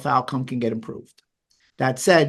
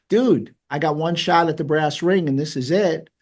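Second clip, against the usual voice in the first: normal talking voice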